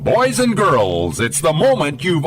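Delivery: slow showman's build-up intro; grand entrance announcement. Acted. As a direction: announce it like a big show opener. Radio show opening announcement.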